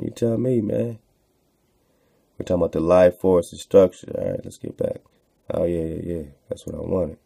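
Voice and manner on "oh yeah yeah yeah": ordinary talk, nothing marked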